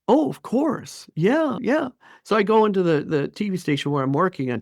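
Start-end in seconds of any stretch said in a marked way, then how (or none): 0.1-1.9 much higher, breezy oblivious yes-yes; mock eager agreement. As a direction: brush it off in a high chirpy voice. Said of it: "And I'm like".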